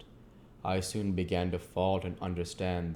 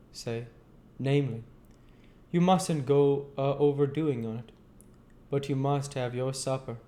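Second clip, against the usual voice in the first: higher-pitched Joe, earnest and homely; Joe's voice; 7 semitones higher, 3 dB louder than usual. Acted. Joe talking gently to old Pip, in a higher voice. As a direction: pitch up into Joe, a plain simple man.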